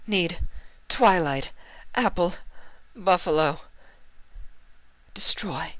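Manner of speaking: high, faint fragmented squeaks; squeaked out. A narrator's reading. Trixie slipping from consciousness, squeaked words. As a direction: squeeze each word out as you fade. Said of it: "squeak out a few words".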